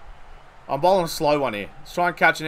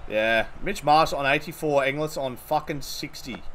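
usual speaking voice

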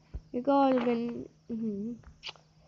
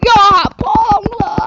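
much higher and louder yell at the opponent; furious shout; 7 semitones higher, 13 dB louder than usual. Real, not acted. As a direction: bellow it in full frustration. Yelling at enemy player.

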